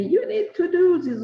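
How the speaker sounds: high-pitched mock nagging; insistent urging. Retelling a friend's nagging about webinars, insistent and high.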